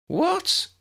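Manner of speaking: sudden high startled what; shocked squeak. A narrator's reading. Lydia hears the vampire rumour, high startled yelp. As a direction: yelp it in disbelief.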